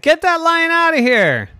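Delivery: hammed-up order to clear the field; announcer shouting at the lion, high and loud; announcer yelling. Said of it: "And the announcer said".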